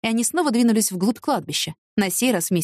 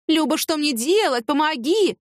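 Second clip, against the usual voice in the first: mock tearful plea; 6 semitones higher, slower than usual. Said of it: "вся в соплях и слезах".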